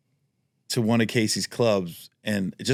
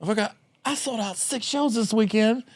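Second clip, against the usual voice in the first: much higher, put-on voice of a defiant headliner; mock Ralphie; 13 semitones higher than usual. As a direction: lord it over the new manager, hammed-up. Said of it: "He goes".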